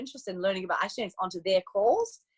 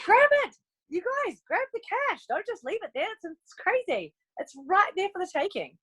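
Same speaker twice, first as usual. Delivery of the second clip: much higher, bursting start, then pleading; urgent excited plea; 9 semitones higher than usual. Retold or imitated. Coach to team about expiring bonus, urgent. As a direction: urge them to grab it now. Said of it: "I'm like".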